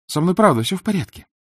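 put-on high young girl's voice; reassuring girl. A narrator's reading.

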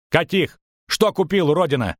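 high-pitched, exasperated demand; angry questioning. A narrator's reading. Merchant grills his double about the goods, angry.

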